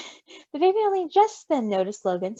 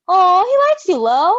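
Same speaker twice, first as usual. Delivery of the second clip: higher and louder, gushing; delighted aw; 7 semitones higher, 9 dB louder than usual. Acted. Roman to Logan, gushing that the baby likes him. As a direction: coo with delight.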